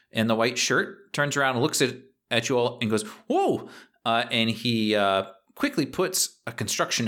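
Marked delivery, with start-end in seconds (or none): 3.3-3.7 surprised whoa. Acted. GM voices boss surprised.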